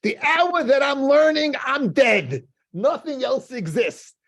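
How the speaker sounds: high, loud, slow and impassioned; husband answering his wife with a high, loud, ardent vow; fervent declaration. Retold or imitated. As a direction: proclaim it like a sermon's climax, each phrase hammered out. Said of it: "He goes".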